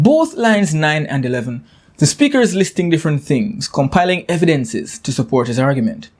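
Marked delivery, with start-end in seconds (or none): none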